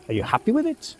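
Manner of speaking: usual speaking voice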